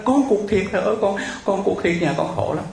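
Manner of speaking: high-pitched pleading cry; imitated child's wail. Girl insists to the monk she truly lost her legs, wailing. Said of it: "Nó gào lên".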